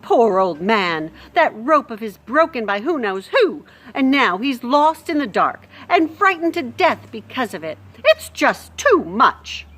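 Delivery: loud grieving lament; lamenting cook. Acted. Cook laments old man.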